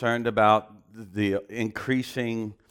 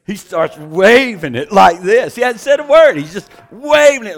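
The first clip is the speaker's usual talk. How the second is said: much louder and higher, building to a near shout; excited; 8 semitones higher, 14 dB louder than usual. Real, not acted. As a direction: preach the climax with rising thrill. Preacher excited, climax of story.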